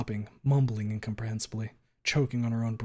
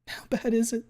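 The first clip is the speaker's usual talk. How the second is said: tearful question; 11 semitones higher than usual. Acted. Fang asks in tears.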